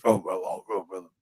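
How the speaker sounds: imitating a low rumbling voice; lightly voiced gravelly grunts; low gravelly groan. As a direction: mimic a gravelly old coach grunting. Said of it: "this low, gravelly voice".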